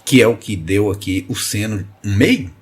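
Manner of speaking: normal talking voice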